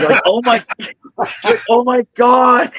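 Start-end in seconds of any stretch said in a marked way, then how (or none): 0.3-0.6 girlfriend's mock dismay. Girlfriend suffering from salt, retold. 1.7-2.7 high, drawn-out imitated wail; girlfriend's mock agony. Girlfriend gulping water after salty ham, mock agony. Girlfriend suffering from salt, retold.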